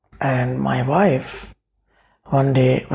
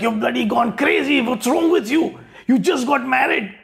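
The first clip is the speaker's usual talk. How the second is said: imitating an outraged father, much higher and wild; mock ranting dad, pitched way up; ballistic dad; 8 semitones higher than usual. Son retells his dad going ballistic over the idea, high mock rant. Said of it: "he went ballistic".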